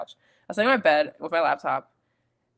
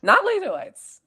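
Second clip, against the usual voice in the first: emphatic denial; 6 dB louder than usual. Real, not acted. Correcting the cohost.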